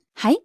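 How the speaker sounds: はい lifted at the end as a question; inquiring はい with a rising finish; questioning response. Retold or imitated. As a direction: answer はい as "yes, what is it?". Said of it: "and the woman went".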